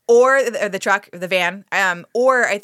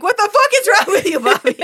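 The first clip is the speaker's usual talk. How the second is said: high, loud mock outrage; imitating yelling; 11 semitones higher, 6 dB louder than usual. Viewer yelling at the TV over a movie character, mock yell. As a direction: re-enact an exasperated yell at the screen. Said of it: "you were yelling at the T V".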